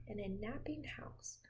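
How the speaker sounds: soft whisper. Pretend. Telling the child about the house, whispered. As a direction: hush it down.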